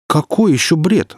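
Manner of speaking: high, slow outraged question; indignant head. Acted. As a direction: bristle at being called delirious. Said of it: "возмутилась голова".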